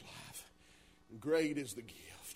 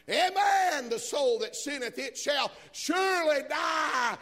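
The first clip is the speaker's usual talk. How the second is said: high, loud, fiery preaching; preaching at full force; 10 semitones higher, 13 dB louder than usual. Acted. Preacher warning the congregation of judgment, shouting high.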